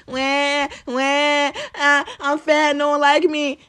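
mock whining wah wah; lightly mocking whiny fat complainer; mock crybaby. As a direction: whine like a crybaby. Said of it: "he said".

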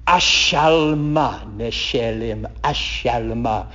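slow, deliberate Hebrew, each phrase set apart by pauses; slow Hebrew reading. Shown as a demonstration. Teacher to congregation, reading the Hebrew slowly. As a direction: read it slowly so they catch the name. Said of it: "I'm gonna read it very slowly".

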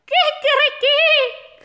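long, very high rooster crow; crowing proud rooster. Acted. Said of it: "krähte der Hahn".

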